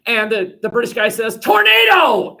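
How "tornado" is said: high, drawn-out yell; slow, loud cry of alarm; shouted false alarm. Acted. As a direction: bellow it as a diversion. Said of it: "I'll just shout something".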